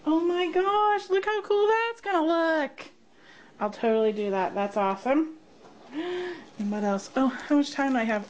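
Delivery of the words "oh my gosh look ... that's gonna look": high-pitched thrilled gushing; excited. Real, not acted. Crafter showing viewers the goodies, excited. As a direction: gush high with delight.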